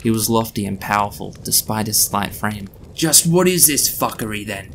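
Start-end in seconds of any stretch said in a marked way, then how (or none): 2.9-4.7 put-on higher, old man's menacing growl. A narrator's reading. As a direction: growl at the intruders. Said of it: "he growled".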